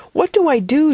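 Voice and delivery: high-pitched plea for help; calling out. A narrator's reading. Daughter calls to dream friend for help, calling out. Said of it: "she called".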